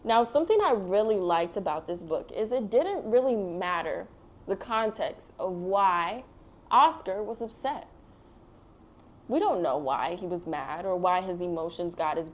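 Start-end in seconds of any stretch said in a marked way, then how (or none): none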